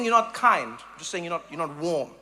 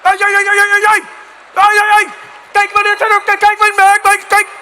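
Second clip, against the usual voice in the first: high, very loud mock-Dutch ranting; losing it; 10 semitones higher, 10 dB louder than usual. Dutch cyclists coming at full speed, mock furious yelling. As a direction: yelp and jabber in hammed-up fury. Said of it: "people lose their shit".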